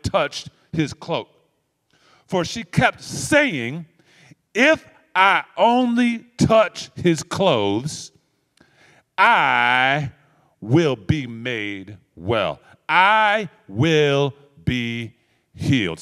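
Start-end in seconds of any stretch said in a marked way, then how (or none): 4.5-16.0 very slow, stretched-out declaration of faith; slow earnest resolve. Acted. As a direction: linger on each word like a vow. Voicing the woman's inner resolve.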